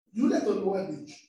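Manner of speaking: ordinary talk, nothing marked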